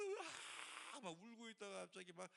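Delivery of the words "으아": slow, high mock cry of a sister in prayer; a drawn-out imitated scream, not full force; imitated wail. Pastor acting out the sister's sudden cry, mock wail. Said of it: "막 소리 지르면서".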